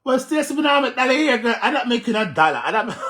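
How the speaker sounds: high loud laughing protest; real laughter. Real, not acted. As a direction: laugh through the words. Laughing reaction to crying clip.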